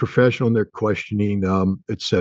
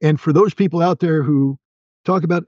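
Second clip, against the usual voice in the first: ordinary talk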